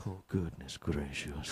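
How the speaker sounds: put-on very soft, low, slow Indian accent; hushed Indian-accented phone caller. Indian caller reaching a phone line, soft Indian accent. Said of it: "an Indian dude on the sex".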